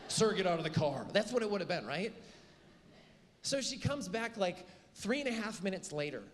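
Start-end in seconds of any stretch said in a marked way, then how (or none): 0.1-1.0 loud barked police order; mock officer command, louder; stern cop. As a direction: bark it like a cop at a traffic stop. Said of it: "she probably would have pulled her gun on me".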